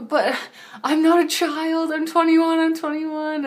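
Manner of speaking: high, loud pleading cry; desperate protest. Retold or imitated. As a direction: plead loudly that you're an adult. Being taken as a child.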